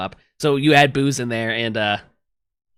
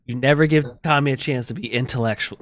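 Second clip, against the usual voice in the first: ordinary delivery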